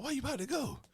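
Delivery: pleading. Retold or imitated. Man to the woman about to leave, mock pleading. Retold plea to departing woman.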